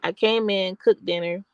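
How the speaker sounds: usual speaking voice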